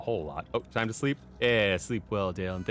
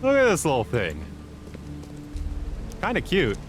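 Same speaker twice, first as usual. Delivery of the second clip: cooing; 9 semitones higher, 6 dB louder than usual. Real, not acted. Cooing at a creature.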